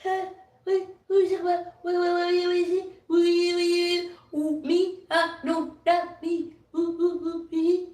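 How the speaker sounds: high, stammering fake innocence; squeaky mocking stammer of someone dodging blame; mock flustered stammer. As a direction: play a caught liar stuttering who me. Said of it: "they be saying".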